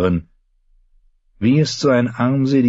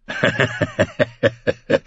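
light run of chuckles; acted laugh; 5 semitones higher than usual. A narrator's reading. Brother laughs at the queen's praise of Osmond, an amused chuckle. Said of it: "Jane lachte".

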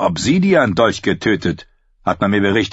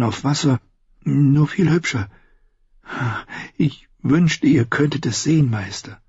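put-on high, hesitant young man's voice; timid, awed Sam; 6 semitones higher than usual. Sam describes the sword to his blind master, awed.

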